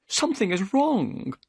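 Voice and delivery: fast, high, worried; Thaddeus Sholto. A narrator's reading. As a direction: rush it out in alarm. Thaddeus alarmed.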